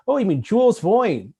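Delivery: a cheeky Brooklyn impression of Asimov; thick Brooklyn accent with a big rising "Oh"; Asimov's Brooklyn accent. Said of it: "in his Brooklyn accent".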